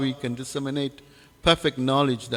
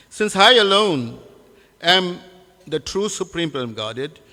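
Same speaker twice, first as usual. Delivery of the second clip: measured proclamation, higher at the start, long stressed words; declamatory letter reading; 3 semitones higher, 3 dB louder than usual. Acted. Preacher reading a false king's letter to Krishna, declamatory. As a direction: read out a king's demand with weighty pauses. Reads King Pondrak's letter aloud.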